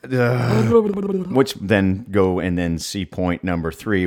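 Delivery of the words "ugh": high, loud ugh of disgust. Real, not acted. Reaction to gross image.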